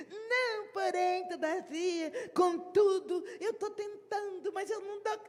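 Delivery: high, whiny string of excuses, a mock sob on porém; evasive excuse. Timid member dodging the question, whiny excuses. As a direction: squirm out of the task, whining.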